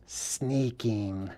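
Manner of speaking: very soft, low retort; feigned sarcasm. Retold or imitated. Hobbit admits the truth to Sam, feigned sarcasm. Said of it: "pretending like he's being sarcastic".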